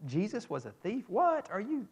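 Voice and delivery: What? jumps way up in pitch, mock disbelief; half-playful retold shock; incredulous. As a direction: act out mock disbelief at a silly claim. Mock reply to an absurd claim.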